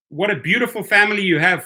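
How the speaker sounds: ordinary talk, nothing marked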